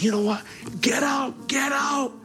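high, raw shout, voice cracking; furious retold yell with a cry in it; angry yelling. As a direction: throw them all out at the top of your voice. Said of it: "I began yelling".

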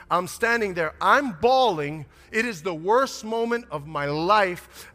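normal talking voice

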